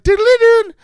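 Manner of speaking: high, loud doodly-doo; mock cartoon sound; imitated jingle. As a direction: sing out a silly falling-out-the-window ditty. Sings an imagined cartoon sound.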